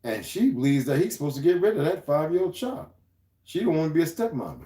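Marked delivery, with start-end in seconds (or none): none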